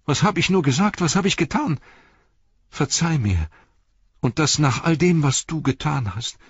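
high, anguished self-reproach; crying out. A narrator's reading. Frodo begging Sam's forgiveness after his outburst, anguished. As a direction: plead for forgiveness, pitched high with distress. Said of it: "rief Frodo".

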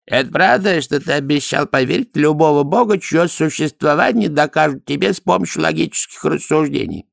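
high-pitched inquisitor priest voice; questioning priest. A narrator's reading. Priest questions Dorfl about believing in gods, challenging. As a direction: put the challenge in a high character voice.

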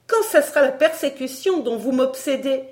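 high, loud, outraged demand; indignant harangue. Acted. Barbara haranguing her supposed persecutor, indignant.

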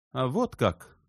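high-pitched, nervy retort; nervous voice. A narrator's reading. Shubin reacts to Elena's hint, nervous and stung. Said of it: "начал он нервным голосом".

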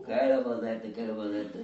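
low, mocking singsong voice. Mocking a showoff villager. (voix moqueuse traînante)

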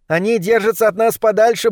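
lightly voiced high rat; rat character. A narrator's reading. Sardines the rat answers the boss. (крысёнок докладывает, высоким голосом)

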